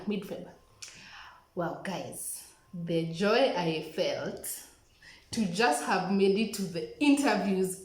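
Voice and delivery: ordinary delivery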